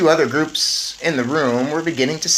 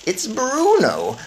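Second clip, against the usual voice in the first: lightly voiced grandmother; 9 semitones higher, 3 dB louder, much slower than usual. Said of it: "my grandmother said".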